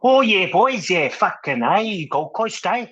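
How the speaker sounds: loud, high Aussie bloke voice; imitating a South African gone Australian; mock Aussie accent. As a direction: ham up a Gold Coast Australian accent. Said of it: "Australian accent".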